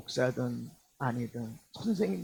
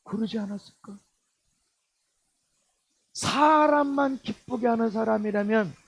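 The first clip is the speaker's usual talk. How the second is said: ordinary talk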